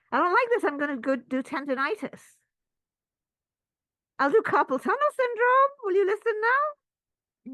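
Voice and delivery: high, loud, petulant threatening whine; a spoiled child sulking and threatening pain; sulky body. Pretend. Body threatening injuries at work, high petulant whine. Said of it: "the body says".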